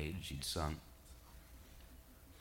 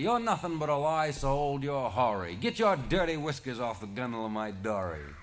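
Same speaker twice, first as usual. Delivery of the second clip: higher, louder gruff taunt; gruff insult; 6 semitones higher, 5 dB louder than usual. A narrator's reading. Charlie tells the serpent off his dory, gruff loud taunt. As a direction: taunt the old monster off your boat.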